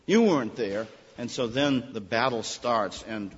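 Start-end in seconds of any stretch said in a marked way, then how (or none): 0.1-0.9 louder, indignant complaint; mock-indignant rebuke; accusing retort. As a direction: throw the blame back at them. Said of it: "He says".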